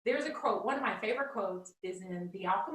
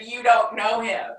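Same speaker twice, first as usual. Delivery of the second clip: much louder, punchy and triumphant; emphatic retort; 11 dB louder than usual. Retold or imitated. Repeating her comeback to the Harvard classmate, louder and triumphant. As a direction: hit the line hard like the final word. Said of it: "I said".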